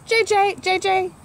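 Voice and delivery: a light mock shriek of a jay; mild blue jay shriek. Reading to kids, a lightly done jay shriek. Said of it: "Blue jay's shriek is as sharp".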